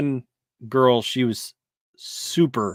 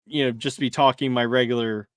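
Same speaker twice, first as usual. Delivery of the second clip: ordinary talk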